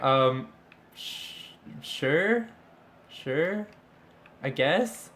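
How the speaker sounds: hesitant girls, mock unsure. Retold girls' hesitant reply.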